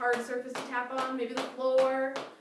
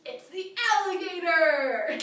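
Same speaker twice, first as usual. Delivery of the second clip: higher, louder slow announcement; excited reveal; 8 semitones higher, 9 dB louder, slower than usual. Acted. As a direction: reveal it with glee.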